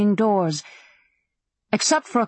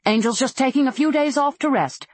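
higher, brisk dismissal; sharp; 7 semitones higher than usual. A narrator's reading. Madam covering for an absent girl, sharply. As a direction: fob him off sharply, pitched up. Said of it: "the Duchess answered sharply".

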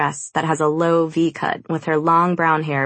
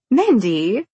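protesting; 6 semitones higher, 3 dB louder than usual. A narrator's reading. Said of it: "Brianna protests".

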